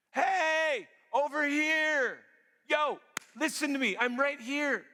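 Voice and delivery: loud and high, calling across a square. Acted. Preacher voicing wisdom calling to people in the street, loud call. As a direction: hail the crowd from across the street. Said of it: "she cries out in the public square".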